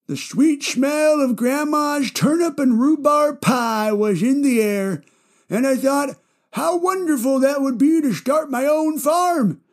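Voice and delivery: put-on high, wobbly old man; grandpa voice. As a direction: play a rambling old grandpa. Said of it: "I just love doing my grandpa voice".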